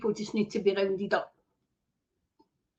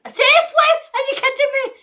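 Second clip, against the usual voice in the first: much higher, loud disbelieving cry; incredulous outburst; 12 semitones higher, 8 dB louder than usual. Real, not acted. Cutting off the quote in disbelief, loud incredulous shout. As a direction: break in, unable to believe it.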